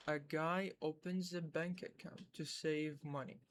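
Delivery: very slow, a little soft; slow new-level reading. Shown as a demonstration. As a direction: read the longer line slowly for shadowing. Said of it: "وهسه الليفل الجديد". (قراءة بطيئة للمستوى الجديد)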